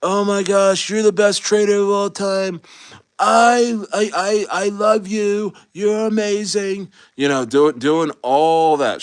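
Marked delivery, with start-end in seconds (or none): 0.0-6.9 hammed-up adoring flattery; mock Trump gushing. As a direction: fawn over the trader in a mock Trump voice. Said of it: "he's gonna be like".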